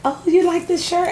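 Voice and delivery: high-pitched friendly question; imitated stranger. Acts out the woman asking.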